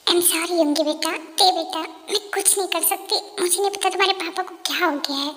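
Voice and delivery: a mother who can't help her sons, almost under her breath; sad and hushed. Acted. Mother helplessly apologising to sons. (धीमी, उदास, बेबस आवाज़ में)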